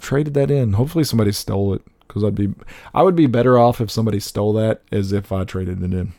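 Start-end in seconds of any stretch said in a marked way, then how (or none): none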